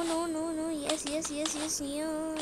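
ordinary delivery

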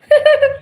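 quick high loud laugh; laughing at her own thank-you; short laugh. Real, not acted.

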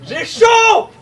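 plus haut et plus fort; plainte criée. Acted. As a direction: crier qu'on étouffe de chaleur. Improv, character overheating. (crier de chaleur)

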